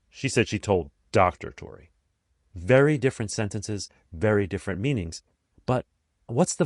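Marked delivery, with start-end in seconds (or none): 0.1-1.9 DOCTOR set apart after a short pause; stress on DOCTOR. Shown as a demonstration. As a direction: hold back, then stress "Doctor". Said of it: "Changing the emphasis changes the entire meaning".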